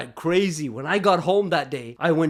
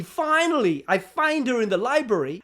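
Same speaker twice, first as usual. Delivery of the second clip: usual speaking voice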